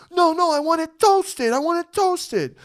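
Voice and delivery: high, loud, drawn-out mock complaint; demanding customer. Customer rejecting the deli worker's toasting, demanding. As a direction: imitate a customer slowly insisting at full pitch.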